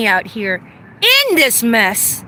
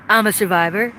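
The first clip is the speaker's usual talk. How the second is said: I'm a survivor dropped lower, to himself; a lower echo of the first line; lower, settled repeat; 6 semitones lower than usual. Shown as a demonstration. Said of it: "I'm a survivor repeated".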